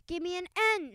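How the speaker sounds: high, bright cheer call; cheerleader shout. A narrator's reading. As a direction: lead the chant. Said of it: "shouted the cheerleaders".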